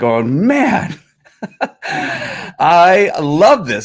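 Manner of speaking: high, drawn-out delight with a laugh; tickled, high-pitched and stretched out; delighted laughing reaction. Retold or imitated. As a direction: laugh through it, relishing every word. Retelling delighted reaction to clip.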